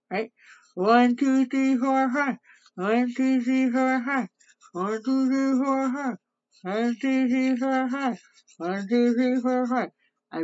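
talking while brushing teeth; toothbrush in mouth. Real, not acted. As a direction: mouth partly blocked by brush. Seen 3: Toothbrush clearly in her mouth, actively brushing during all counted words.

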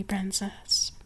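breathy whispered pet name. Acted. Mistress whispering a pet name to her princess. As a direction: whisper it close to the ear.

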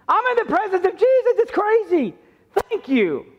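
a woman overwhelmed to be near Jesus, pitched far up; awed woman. Acted. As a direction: voice her amazement at meeting Jesus. Preacher voices the weeping woman.

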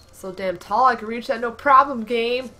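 loud mocking boast; sarcastic shout. Real, not acted. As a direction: shout it in mock triumph. Sarcastic at game.

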